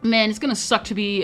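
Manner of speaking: ordinary talk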